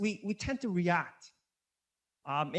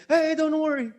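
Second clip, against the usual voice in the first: high, loud put-on pleading; exaggerated defensive yelp; mock knee-jerk reaction; 7 semitones higher, 8 dB louder than usual. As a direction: blurt it out high and loud, imitating a panicky reaction. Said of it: "not a reaction".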